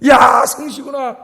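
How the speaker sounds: long drawn-out, loud exclamation; hammed-up wonder at a dream come true; acted amazement. Acting out realizing it is real.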